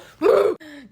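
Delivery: loud dramatic gasp; mock gasp. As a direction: gasp in fake horror. Mock shock.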